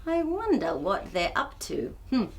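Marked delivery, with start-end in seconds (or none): none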